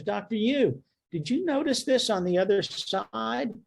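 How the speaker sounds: a hint of the tech gently flagging the missed cancer; curious technologist. Technologist voiced pointing out a miss.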